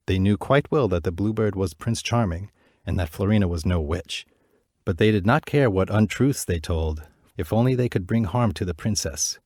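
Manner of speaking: usual speaking voice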